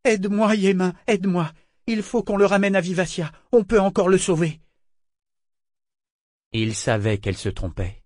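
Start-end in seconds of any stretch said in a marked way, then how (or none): 0.0-4.6 high, urgent plea; anguished joy. A narrator's reading. Eta begs Yémin to help save Kénit, urgently. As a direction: plead high-pitched, with anxious hope. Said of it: "saisie d'une joie angoissée".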